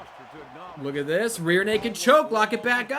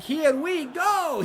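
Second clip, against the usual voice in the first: much higher, slow, stretched "Here we go"; mock Mario voice; 9 semitones higher, 4 dB louder, much slower than usual. Vlogger imitates the actor's trailer line, a light put-on plumber voice. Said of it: "He was doing a little bit of a voice".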